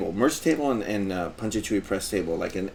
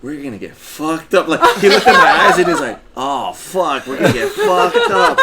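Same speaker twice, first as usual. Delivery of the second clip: loud, higher excited voice of the friend; hyped-up friend; 6 semitones higher, 10 dB louder than usual. Retold or imitated. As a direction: voice him pumped and laughing about getting wasted. Eric hyped about drinking.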